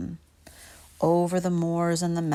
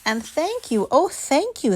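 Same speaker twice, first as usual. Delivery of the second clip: grateful; 9 semitones higher than usual. A narrator's reading. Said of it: "the grateful witch cried".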